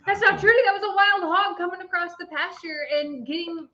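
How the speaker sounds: high, rising, alarmed re-enactment; mock panic. Realising a wild hog is in the pasture, mock panic. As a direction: act out dawning horror, pitched high.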